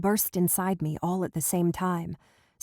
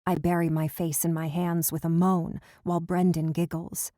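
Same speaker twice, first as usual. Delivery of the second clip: usual speaking voice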